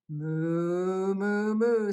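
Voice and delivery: long drawn-out storybook moos; cow mooing. A narrator's reading. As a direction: moo like a cow for small children. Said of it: "said the cows".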